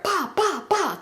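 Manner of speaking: high, punchy "bah" for each shot; imitated gunshots. Storyteller sounding out three shots at the party, imitated gunshots. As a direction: mimic three gunshots with your voice.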